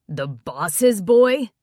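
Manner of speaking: slow, loud, stunned question; shocked. A narrator's reading. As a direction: drag it out in disbelief. Shocked friend reacts to news.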